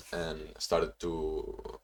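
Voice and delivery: usual speaking voice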